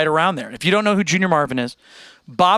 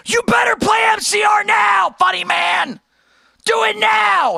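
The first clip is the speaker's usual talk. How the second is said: high mock shout of a pushy fan; exaggerated yelling fan voice; demanding fan impression; 3 dB louder, slower than usual. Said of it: "they're very like".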